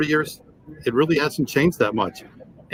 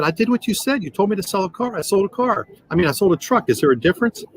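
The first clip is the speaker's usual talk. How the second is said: scared protest; 7 semitones higher than usual. Retold or imitated. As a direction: plead your case nervously, pitched well up. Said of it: "And I'm scared".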